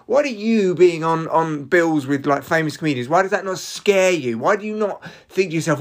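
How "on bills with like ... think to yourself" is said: normal talking voice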